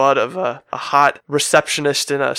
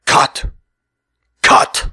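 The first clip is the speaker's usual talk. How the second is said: calling cut; 6 dB louder than usual. Retold or imitated. Director stopping the take.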